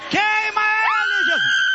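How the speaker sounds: high, drawn-out shouted plea; full-force preaching. Real, not acted. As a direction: cry out to Jesus in fiery deliverance prayer.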